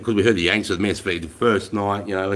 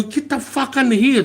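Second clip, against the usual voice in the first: urgent order; 12 semitones higher than usual. Retold or imitated. Yanks order them into shelter.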